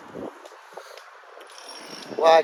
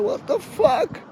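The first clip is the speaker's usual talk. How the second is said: squeaky disbelief; slower than usual. Retold or imitated. Wife vanished, retold reaction.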